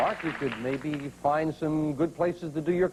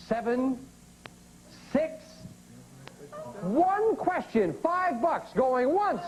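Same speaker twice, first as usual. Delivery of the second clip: rising, eager countdown; excited countdown; 8 semitones higher, slower than usual. Real, not acted. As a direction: build the excitement up high. Host counts down price excitedly.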